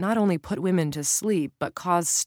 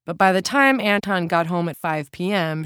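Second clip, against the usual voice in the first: usual speaking voice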